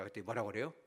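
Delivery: ordinary talk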